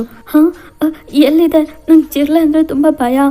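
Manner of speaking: startled by a cockroach, panicky and loud; scared shriek. Acted. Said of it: "sudden ಚೀರಾಡ್ಕೊಂಡು".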